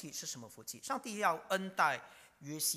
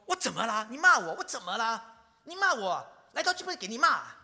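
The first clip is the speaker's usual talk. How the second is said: louder, indignant mock complaint; imitating angry retort; 4 semitones higher, 7 dB louder than usual. Preacher acting a resentful sinner talking back to God, mock angry. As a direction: throw it back as if wronged. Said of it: "很生气".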